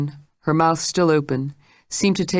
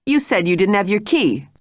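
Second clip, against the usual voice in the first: Jane with false bravado; 5 semitones higher than usual. Acted. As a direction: bluff it out, pretending to be sure. Jane confronts Mrs Stitt.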